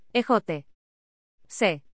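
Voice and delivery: normal talking voice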